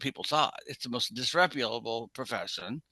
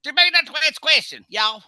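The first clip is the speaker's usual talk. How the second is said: put-on Southern accent; 11 semitones higher, 9 dB louder than usual. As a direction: ham up a Southern twang. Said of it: "that's like this".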